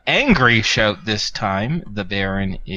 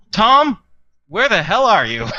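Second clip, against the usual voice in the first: higher, louder yell over the intercom; demanding shout; 11 semitones higher, 5 dB louder than usual. Acted. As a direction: bark for Tom through the speaker. Blink cuts into the intercom.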